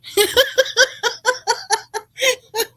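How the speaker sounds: a burst of high real laughter. Real, not acted. Amused by Daisy's comment.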